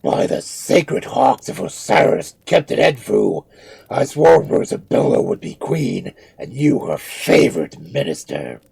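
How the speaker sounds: loud, forceful proclamation; mummy invoking his gods; solemn oath. A narrator's reading. Cheops swears an oath.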